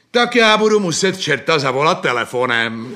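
loud, forceful announcement; loud last-ditch ultimatum; renewed threat. Acted. Mikuláš launches new threat at boy.

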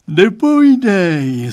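put-on dad yawns at the boy's answer, slow and loud; yawning. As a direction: yawn it out slowly. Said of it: "zívnul pan Stránský".

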